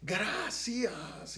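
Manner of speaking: high, loud anguished cry of thanks; shouted thanks to God. Retold or imitated. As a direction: cry out to the Lord in grief. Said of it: "y yo le gritaba". (grito angustiado de gratitud)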